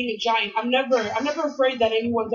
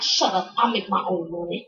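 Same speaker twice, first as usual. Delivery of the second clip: low, dismissive sass; sassy little girl; 7 semitones lower than usual. Retold or imitated. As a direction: brush off the grown-up. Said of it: "She's like".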